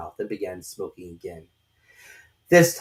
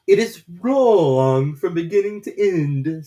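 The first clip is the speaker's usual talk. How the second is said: slow, loud, final verdict; decided caterpillar; 8 semitones higher, 8 dB louder, slower than usual. A narrator's reading. Caterpillar firmly condemning Alice's recital, slow and loud. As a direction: pronounce it with no room for doubt. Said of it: "said the caterpillar decidedly".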